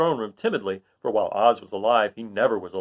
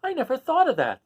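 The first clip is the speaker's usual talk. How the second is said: put-on high, joyful little girl; delighted child; joyful Dorothy; 14 semitones higher than usual. Dorothy thrilled by the Scarecrow's idea. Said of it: "said Dorothy joyfully".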